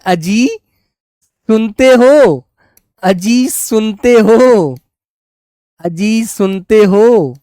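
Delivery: mock wifely call, very drawn out; wife calling husband. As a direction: stretch it out, imitating a wife summoning her husband. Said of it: "आपकी पत्नी आपसे कैसे बोलेगी?". (पत्नी की नकल में लंबा खींचकर पुकारना)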